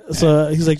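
ordinary talk, nothing marked

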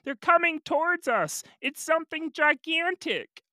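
high, loud lookout's cry; yelling from the crow's nest. Acted. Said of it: "yelling down from the crow's nest".

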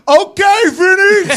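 high, loud hype call; excited cheer. Retold or imitated. Receiver cheering the kicker's first make, high and loud. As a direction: shout it out to hype him up. Teammate cheering a good kick.

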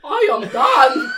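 very loud, high, drawn-out shout; mock grand announcement. Pitcher bursting into the meeting, mock grand shouted announcement. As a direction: bellow it slowly like a hammed-up entrance. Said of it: "exactly how you should go into it".

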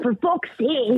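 put-on high, slow Scottish toddler line; Scottish toddler accent. Man imitating the Scottish toddler's swear, Scottish accent. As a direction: drawl it like a wee Scottish girl. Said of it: "really thick Scottish accent".

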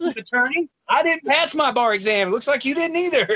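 a snide faux-courteous dig; mock polite jab. Friend mocking a lawyer's lost bar exam, sarcastic and high.

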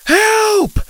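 very high, loud scream for help; shouted. A narrator's reading. Sinking boy shouting for rescue, desperate. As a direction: yell desperately at the top of the voice. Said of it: "Dylan shouted".

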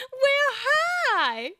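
very high, loud laughing cat voice; snooty bored cat. Retold or imitated. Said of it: "Wasn't there a cat that said".

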